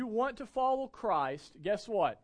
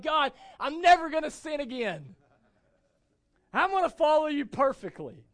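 joyful vow; 9 semitones higher, 10 dB louder than usual. Retold or imitated. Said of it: "the joy in that moment".

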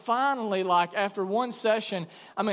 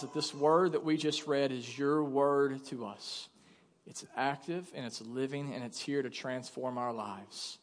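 soft, hushed pastor's prayer to God; 6 semitones lower, 6 dB quieter than usual. Real, not acted. Pastor praying softly over his church, hushed prayer. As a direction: pray quietly and earnestly for the congregation.

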